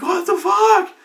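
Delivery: can't believe what just happened; stunned outburst. Retold or imitated. GM shocked again.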